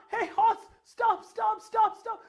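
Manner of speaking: high frantic cry to stop; panicked prince. Acted. Prince on the rearing horse, frantic high shout.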